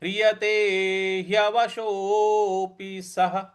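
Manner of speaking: slow, careful Sanskrit recitation; teacher modelling a verse slowly; slow recitation demo. Shown as a demonstration. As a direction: recite syllable by syllable for the learners. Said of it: "ह पर आधा र लगा हुआ है".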